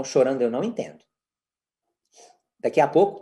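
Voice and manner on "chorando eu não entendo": low, even set phrase; calm, neutral refusal; unchanging intonation. Shown as a demonstration. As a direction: keep it unemotional, no scolding. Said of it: "sem mudar a entonação de voz".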